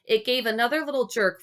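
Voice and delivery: ordinary delivery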